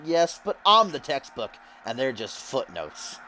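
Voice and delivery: Russell Pontarelli. Acted. Commentator voices the newcomer belittling the roster, cocky wrestler voice. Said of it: "Russell says".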